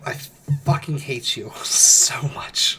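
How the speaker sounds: slow laughing whisper. Pretend. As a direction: breathe it out in a joking whisper. Teasing friend after joke.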